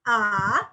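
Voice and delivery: bend "A" down then up; dipping third tone. Shown as a demonstration. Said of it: "kayak gitu".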